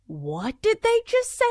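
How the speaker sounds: high-pitched mock bewilderment; high, puzzled outburst; baffled reaction. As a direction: squeak it out in exaggerated disbelief. Acts out baffled listener reaction.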